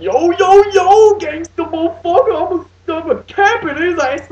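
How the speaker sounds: mock gangsta robots. Mocking the movie's comic robot duo, loud and hammed-up. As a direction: lampoon them as over-the-top cartoon gangsters. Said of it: "they're like".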